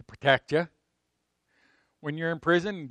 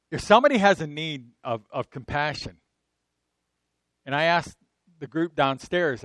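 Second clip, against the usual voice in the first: normal talking voice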